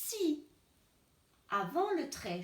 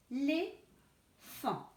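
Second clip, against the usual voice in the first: éléphant drawn out slowly as é-lé-phant; teacher spelling out éléphant slowly; syllable by syllable; much slower than usual. Shown as a demonstration. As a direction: stretch éléphant into slow syllables. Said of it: "couper le mot".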